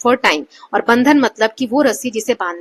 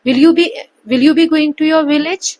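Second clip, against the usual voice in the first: slow, rising toward "village"; rising yes-no tune; 6 semitones higher, 5 dB louder, much slower than usual. Shown as a demonstration. Teacher reads the yes-no example with a rise. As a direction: end the question up. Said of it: "यहाँ पे yes या no में आएगा".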